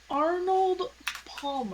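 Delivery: over-enunciate "Arnold Palmer" through a laugh; careful enunciation. Shown as a demonstration. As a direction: spell out "Arnold Palmer" as if it fights you. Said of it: "I have to enunciate very carefully".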